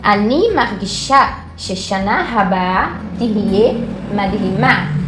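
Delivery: Ani margisha sheshana haba tihye madhima said slowly, word by word; a slow, clear Hebrew sentence; slow model sentence. Shown as a demonstration. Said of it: "segundo exemplo de frase". (frase hebraica dita devagar)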